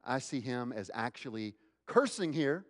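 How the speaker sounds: ordinary talk, nothing marked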